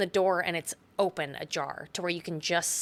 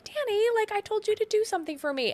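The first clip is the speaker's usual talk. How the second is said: much higher sing-song, fast at the end; sing-songy; faster than usual. Retold or imitated. Wendy trotting over to Danny, sing-songy much higher. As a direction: lilt it like a singing mother. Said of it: "sing-songy".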